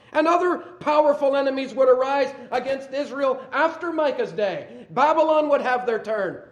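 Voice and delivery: loud, high preaching voice building to a question; fiery preaching. Acted. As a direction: preach it hard to the congregation. Preaching at full force.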